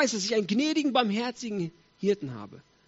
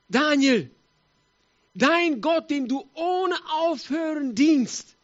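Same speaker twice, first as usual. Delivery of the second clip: high, loud, anguished call; desperate king; 6 semitones higher, 4 dB louder, much slower than usual. Acted. King to Daniel before the lions' den, desperately. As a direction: cry out slowly in helpless despair. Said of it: "der König verzweifelt".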